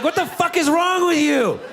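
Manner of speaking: high, indignant woman voice; high outraged woman voice; offended woman. Retold or imitated. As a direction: snap back at him outraged. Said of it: "she'll be like".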